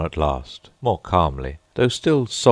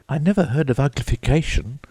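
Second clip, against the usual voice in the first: put-on high quick girlish voice; Alice venturing; 9 semitones higher, faster than usual. A narrator's reading. Alice venturing a question to the Gryphon, high and quick. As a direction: pitch up, a bit faster, timid girl. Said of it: "Alice ventured to say".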